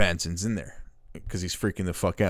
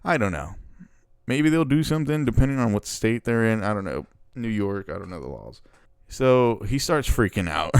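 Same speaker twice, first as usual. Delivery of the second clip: usual speaking voice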